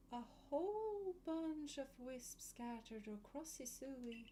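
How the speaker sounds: hushed and haunting, like a ghost; eerie voice. Acted. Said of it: "Quite the eerie voice".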